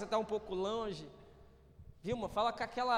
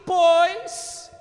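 loud high punch; emphatic pois; 9 semitones higher, 16 dB louder than usual. Real, not acted. Pastor reading scripture, loud emphatic pois. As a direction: hit the word like a drumbeat.

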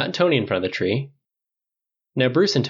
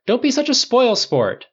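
high-pitched whiny protest; crying out; 13 semitones higher, 4 dB louder than usual. A narrator's reading. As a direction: complain in a much higher voice. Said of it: "Clint cried".

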